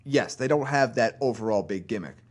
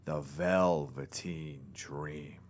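low, slow ring announcer drawl; deep announcer voice; 8 semitones lower, 7 dB quieter, much slower than usual. Shown as a demonstration. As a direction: stretch the name out deep and slow. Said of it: "So you get, like, the deeper, deeper voice".

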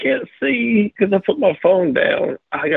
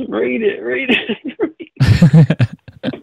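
imitated urging; 6 semitones higher, 4 dB louder than usual. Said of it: "then Sony goes".

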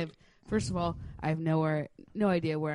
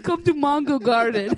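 put-on Jamaican accent; 7 semitones higher, 9 dB louder than usual. Parody ad in Jamaican voice.

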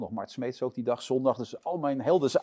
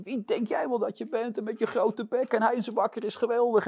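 imitating a furious yell, pitched high; hammed-up rant at the cocky intern; mock shouting; 10 semitones higher than usual. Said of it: "zo verschrikkelijk hard tegen mij te schreeuwen".

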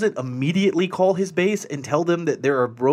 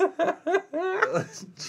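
high-pitched real cackle; high squealing laugh; 4 dB quieter than usual. Real, not acted. Cracking up.

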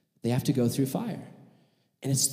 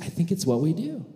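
usual speaking voice

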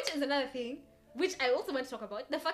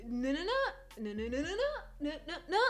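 "no, no, no" sung upward like mocking uptalk; each run of "no" climbing into a question; question intonation; 5 semitones higher than usual. Shown as a demonstration. Mocking their own new uptalk, exaggerated questioning rise. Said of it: "everything sounds like a question".

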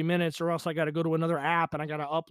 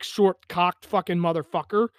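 ordinary talk, nothing marked

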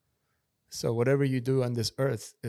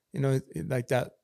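usual speaking voice

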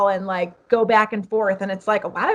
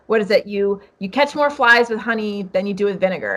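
ordinary talk, nothing marked

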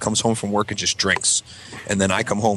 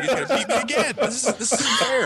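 put-on whining kid. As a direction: complain in falsetto like a kid facing punishment. Kid complaining in dread.

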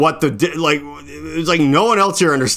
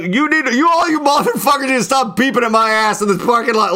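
high, ranting mock outrage; retold angry screaming; 8 semitones higher than usual. Friend orders drivers to stop beeping at him, ranting. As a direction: rant like an indignant friend. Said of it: "we're screaming so loud".

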